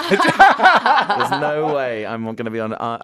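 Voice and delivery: laughing out loud, slow and loud. Real, not acted. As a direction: burst out laughing while refusing. Laughing refusal to perform live.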